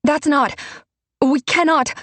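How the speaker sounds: high, loud, pleading protest; desperate to stop the killing; panicked plea. Acted. Alessa begging the crowd not to kill Dante, high and panicked.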